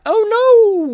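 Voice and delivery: very high, loud comic wail; hammed-up damsel cry of dismay; mock alarm. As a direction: shoot up high and draw out the cry. Heroine's cry of alarm acted out.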